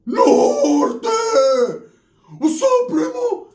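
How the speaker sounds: very high shouting cry with a laugh; excited shout. Retold or imitated. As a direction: scream it across the room overjoyed. Cousin shouts greeting.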